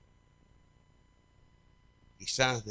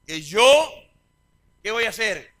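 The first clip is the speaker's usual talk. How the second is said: preached quote of Jesus; 8 semitones higher, 13 dB louder than usual. Acted. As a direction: proclaim it like a revival preacher. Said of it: "¿Qué dijo?".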